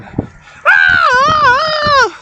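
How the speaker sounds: high mock scream. Big athlete screams again in the bathroom, mock screaming. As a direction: imitate the athlete's piercing scream. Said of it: "Screams again".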